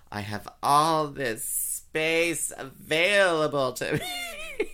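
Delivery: slow, high, laughing gleeful voice; mock gleeful parent. Imitating a parent enjoying the empty house. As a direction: relish every word of it. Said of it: "You're like".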